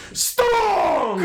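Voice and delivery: put-on high loud Russian accent. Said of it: "it says in this weird Russian accent".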